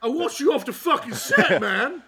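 hammed-up English tirade. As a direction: tear into a crew member like a furious star.

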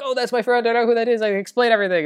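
high-pitched hurried plea, imitated; mock pleading to get past security; urgent protest. Retold pleading while shoved away.

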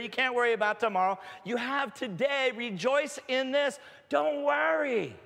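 high, forceful preacher's plea; emphatic exhortation. Acted. Jesus telling worriers to take one day, emphatic exhortation. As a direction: urge it with rising conviction, drawing out the last phrases. Voicing Jesus urging listeners not to worry.

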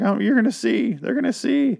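very high, whiny mock threat; mock victim. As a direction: imitate a helpless victim in a squeaky high voice. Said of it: "She's like".

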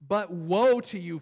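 loud, slow woe; slow, loud prophetic warning; stern rebuke. Acted. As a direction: thunder the woe. Reading Jesus' rebuke.

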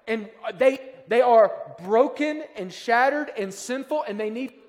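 preacher thundering at the congregation; full-force preaching. Acted. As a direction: preach it like a warning from the pulpit.

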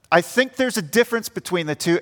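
ordinary talk

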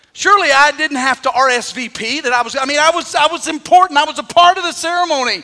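climbing anguished protest; anguished plea. Retold or imitated. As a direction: plead that you mattered, climbing. Singer pleading to her husband.

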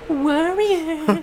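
high sing-song taunting call; Warriors movie taunt. Acted. Co-host imitates the movie villain, taunting call. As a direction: stretch it out in a high mocking chant. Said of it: "that scene from The Warriors".

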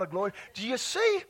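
usual speaking voice

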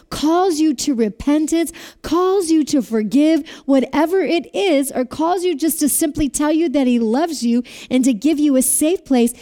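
ordinary delivery

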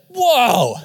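a loud, high whoa, taken aback; overwhelmed whoa. Real, not acted. Pastor recalling the boasting strangers, a loud stunned whoa. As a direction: let the whoa burst out.

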